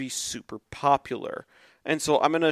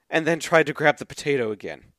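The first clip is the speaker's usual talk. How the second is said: usual speaking voice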